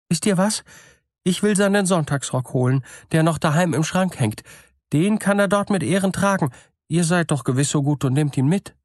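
eager to hear of her dead husband; gullible woman's voice. A narrator's reading. Woman offers Sunday coat. (gutgläubige Frau, eifrig und höher)

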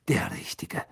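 hissed sarcasm; sneering whisper. A narrator's reading. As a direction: breathe it out with contempt. Snape's sneering repeat.

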